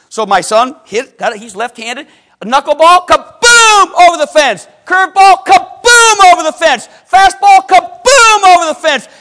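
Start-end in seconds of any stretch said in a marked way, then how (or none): 2.4-9.1 booming, high shout on each long kaboom; excited home-run call. Retold or imitated. Father relives his son's home runs at tryout, excited booming call. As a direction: call the hits like a ballgame, loud and high.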